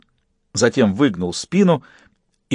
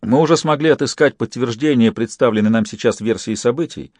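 usual speaking voice

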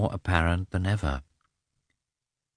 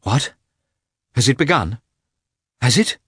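alarmed questions, higher and louder; frightened voice; 6 semitones higher, 6 dB louder than usual. A narrator's reading. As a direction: ask in sudden fright. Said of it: "he asked in a frightened voice".

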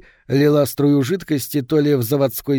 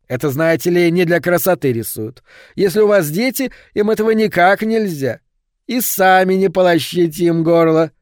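higher, louder, amused clerk; jovial clerk warning; 5 semitones higher, 4 dB louder than usual. Acted. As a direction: warn him cheerfully, half laughing. Продавец предупреждает, затем хохочет. (бодрый продавец, чуть посмеиваясь)

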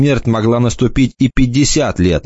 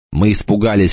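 usual speaking voice

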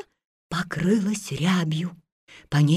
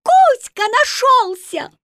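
excited shout; 6 dB louder than usual. Acted. Mermaids call joyfully.

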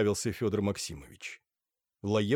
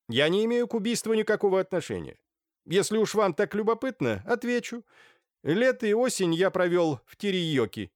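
high-pitched haughty reply; defensive Naledinsky; 10 semitones higher, 3 dB louder than usual. A narrator's reading. Naledinsky denies any link to the murder, high haughty voice. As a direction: answer loftily in a raised voice.

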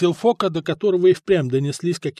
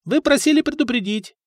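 put-on high-pitched insistent serving boy; youthful, higher insistent voice; 9 semitones higher than usual. A narrator's reading. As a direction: press the reminder in a raised pitch. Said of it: "настойчиво сказал паренёк".

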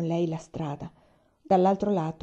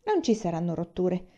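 usual speaking voice

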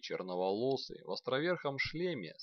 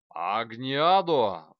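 loud, drawn-out incantation shout; shouted spell; 12 dB louder, much slower than usual. A narrator's reading. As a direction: cry out the spell. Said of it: "выкрикнула она".

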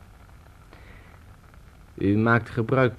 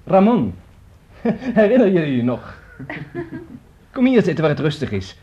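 high, loud and laughing with delight; giddy, higher and louder, laughing through the words; excited laughing; 10 semitones higher, 6 dB louder than usual. Acted. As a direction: burst out in joyful laughter, then hurry on. Joyful reunion in memory.

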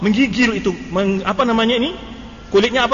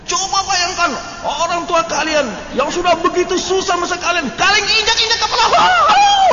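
mock dramatic speech, high and rising into a wail; campfire indoctrinator; 8 semitones higher than usual. Tutor working a campfire crowd to tears, hammed-up mimicry. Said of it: "doktrin-doktrin ya".

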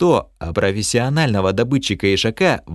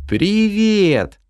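cheery elf greeting; 10 semitones higher than usual. Acted. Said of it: "поздоровался с нами эльф".

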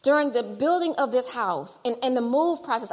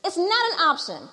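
loud, higher, fervent exhortation; preaching at full force; 5 semitones higher, 5 dB louder than usual. Real, not acted. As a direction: preach it to the congregation.

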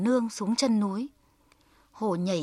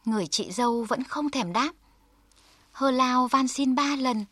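ordinary delivery

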